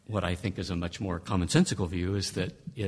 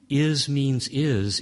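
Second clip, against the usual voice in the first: "is" means "is", each word hit higher and louder; mock emphatic echo; 5 semitones higher, 4 dB louder, much slower than usual. Lecturer echoing Luther's slogan to mock it, stressed "is" means "is". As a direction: punch "is" and "is" as if it were obvious.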